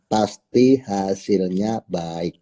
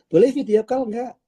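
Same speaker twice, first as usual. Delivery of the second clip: much higher, light asking voice; friendly leader; 12 semitones higher than usual. Acted. Leader asking the member for a video call, high friendly voice. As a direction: ask sweetly for a video call.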